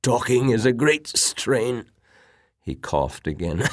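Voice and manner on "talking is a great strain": put-on higher-pitched, effortful old invalid; strained sick old man. Sick admiral apologises to his visitor, strained old voice. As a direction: talk as if each phrase is a strain.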